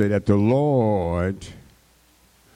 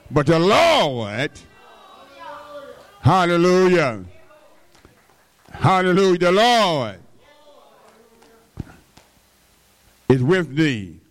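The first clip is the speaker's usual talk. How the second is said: preached at full force; 8 semitones higher than usual. Acted. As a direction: lift it like a preacher at full cry. Preaching the angel's words.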